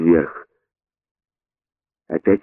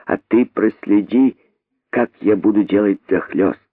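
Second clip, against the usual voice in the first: usual speaking voice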